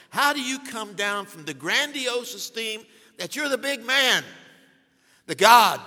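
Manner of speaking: rising from firm to a loud cry on God; building preacher shout. Real, not acted. Preacher mocking the pastor's grandiose esteem, building to a loud cry. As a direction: swell it into a full-throated shout by the end.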